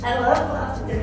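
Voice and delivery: normal talking voice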